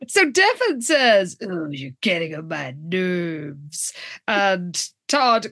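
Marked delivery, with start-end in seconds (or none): 1.4-3.9 low, slow, soft grumble; surly retort. Retold or imitated. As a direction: imitate a moody guy, drawn out and low. Acting out surly Devon's reply.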